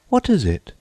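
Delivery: put-on high quick girlish voice; Alice venturing. A narrator's reading. Alice venturing a question to the Gryphon, high and quick. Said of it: "Alice ventured to say".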